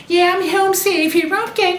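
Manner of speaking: high, chirpy mock girly phone voice; hammed-up carefree girl chatting; Betty on the phone. Betty chats to Susie on her cell, in a high chirpy mock girly voice. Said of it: "she's talking on her cell phone".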